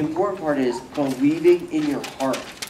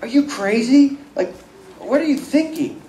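much higher, shocked disbelief; can't believe what he's hearing; incredulous; 10 semitones higher than usual. Retold or imitated. Criminal on the cross rebuking the mocker, incredulous and much higher.

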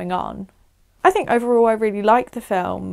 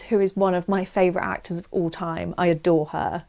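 usual speaking voice

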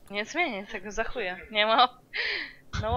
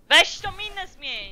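insistent demand; 7 semitones higher, 6 dB louder, slower than usual. Real, not acted. Nagging streamer to change face.

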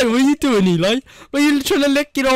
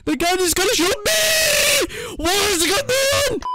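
mock panic; 5 semitones higher than usual. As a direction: cry out as if your gun turned on you. Mock panic at a glitching gun.

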